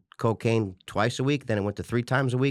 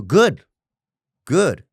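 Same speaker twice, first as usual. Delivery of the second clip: loud, higher, punchy affirmation; emphatic good; 6 semitones higher, 9 dB louder than usual. Real, not acted. Coaching the listener to welcome bad things, loud emphatic. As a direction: bark it out like a coach embracing the challenge.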